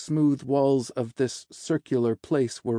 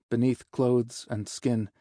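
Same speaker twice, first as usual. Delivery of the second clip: usual speaking voice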